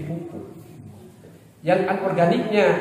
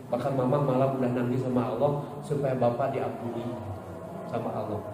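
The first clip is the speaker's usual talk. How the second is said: quiet, low voice of a lightly acted wife; wife to husband; 7 semitones lower, 8 dB quieter than usual. Wife tells husband she cried to God for him, hushed. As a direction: confide softly as a forgiving wife. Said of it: "suami ibu".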